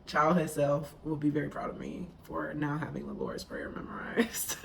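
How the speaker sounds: normal talking voice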